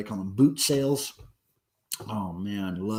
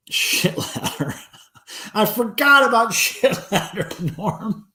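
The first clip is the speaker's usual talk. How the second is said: laughing; 8 semitones higher than usual. Real, not acted. Sudden laughing recall.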